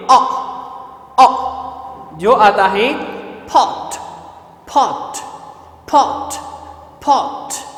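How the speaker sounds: rounded o in "पॉट", repeated; vowel demo. Shown as a demonstration. Teacher modeling the o vowel in "पॉट". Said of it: "पांचवा sound है".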